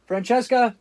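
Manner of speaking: shouting a name up high and loud; calling out. Pretend. Man jokingly calls his imaginary French maid, loud and high. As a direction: call out loud across the house.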